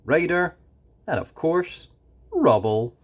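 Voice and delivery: ordinary delivery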